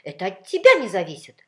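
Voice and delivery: very loud, indignant cry; loud squabbling comeback; shouted retort. A narrator's reading. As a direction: yell back as one with your opponent. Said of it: "хором скричали спорщики".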